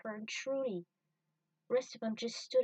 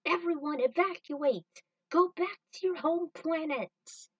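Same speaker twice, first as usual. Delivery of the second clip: higher, loud slow command; urgent shout; 7 semitones higher, 6 dB louder than usual. Acted. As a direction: shout over the collapsing base. Ordering evacuation.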